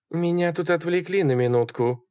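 high-pitched voicing of a woman; high female character voice; Sally's voice. A narrator's reading. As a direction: explain it in her high voice. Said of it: "сказала она".

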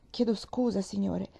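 usual speaking voice

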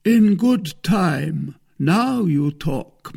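very slow, high-pitched approval; lofty Musketeer. A narrator's reading. Porthos approves the wife's offer, lofty. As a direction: draw it out with lofty satisfaction. Porthos softens toward the wife.

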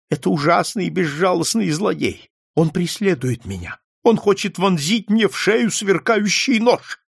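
put-on high, agitated old client; frightened old man. A narrator's reading. Old man tells detective of red devil, high and frightened. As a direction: blurt out the danger in a high voice.

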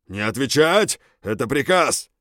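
loud barked command; barked order. A narrator's reading. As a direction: bark the order at the soldiers. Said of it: "рявкнул старший горгун".

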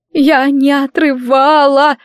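high, slow tearful protest; accused girl sobbing out her innocence; sobbing wail. Acted. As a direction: wail the denial through sobs. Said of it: "всхлипнув, завыла Гизелла".

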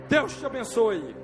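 ordinary delivery

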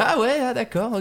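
high, softened imitation of his agreeable reply. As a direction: mimic an adorably polite "oh, okay". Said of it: "il est adorable, il fait".

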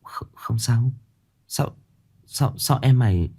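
halting, softly faltering reply; stammering. A narrator's reading. Chanh to her friend Lam, stammering. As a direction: stumble over the first words. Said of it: "ấp úng".

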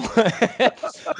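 bright high burst of laughter; real laugh after the punchline. Real, not acted. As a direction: laugh at your own joke. Comedian laughs at own joke.

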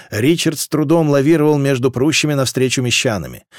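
ordinary delivery, nothing marked

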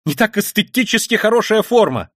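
high, loud, impassioned ranting; frenzied tirade. Acted. Raskolnikov ranting at his sister, frenzied and loud. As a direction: hurl it all out in frenzy.

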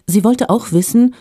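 ordinary delivery

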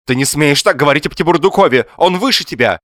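high, loud, indignant wife; shrill scolding outcry; sudden wailing. A narrator's reading. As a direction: burst out in defence of her lover. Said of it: "и вдруг заголосила".

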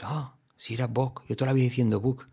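quiet 'ah, so that's it' reaction; mild dawning realization. Acted. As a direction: play a learner just catching on. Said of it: "muchos diréis".

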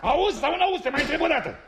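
high, insistent demand; exasperated challenge. Acted. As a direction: press him, losing patience.

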